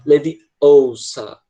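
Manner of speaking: Leviosa with long, stressed British vowels, said slowly. Retold or imitated. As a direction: draw out the vowels of Leviosa like Hermione. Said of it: "essas vogais são, tipo, bem enfáticas".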